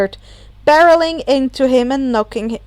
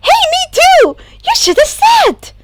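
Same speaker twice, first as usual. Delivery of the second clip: excited; 8 dB louder than usual. Acted. Hagakure excited, grinning.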